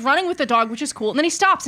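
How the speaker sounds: normal talking voice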